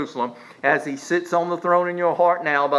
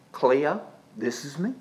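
quiet voice of God; 6 dB quieter than usual. Retold or imitated. The Lord calling the preacher by name, quiet voice. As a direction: drop to a gentle, private volume.

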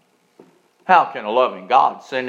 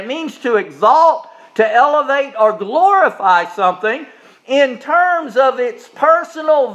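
high, loud, emphatic preaching; full-force preaching; 9 semitones higher, 7 dB louder than usual. Acted. Preacher urging the congregation about the Heisman, loud and high. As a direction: lift it up and sell the point. Preacher raising the sermon again.

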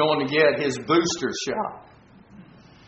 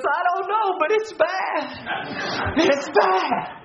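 high-pitched imitation of an upset child; mock-tearful high voice with laughter; crying little boy; 13 semitones higher than usual. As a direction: play a scared cartoon kid, hammed-up. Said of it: "and he's crying".

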